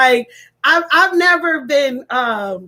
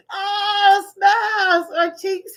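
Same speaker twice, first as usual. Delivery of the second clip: high words broken by helpless cackles; aching, uncontrollable laughter; can't stop laughing; 7 semitones higher than usual. Real, not acted. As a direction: gasp it out between fits of laughter. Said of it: "I can't stop laughing".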